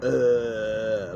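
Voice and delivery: long, low throaty groan; throat drone. Shown as a demonstration. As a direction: let a low sustained sound out on the breath. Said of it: "with my throat".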